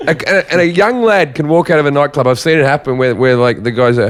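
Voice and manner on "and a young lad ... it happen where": normal talking voice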